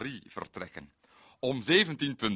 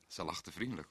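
ordinary talk

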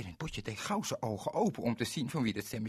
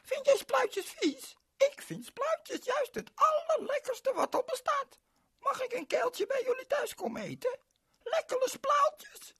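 put-on much higher, louder girl voice; eager little girl; 7 dB louder than usual. Ling invites Berend to her father's restaurant, eager high girl. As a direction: beg him eagerly as the girl.

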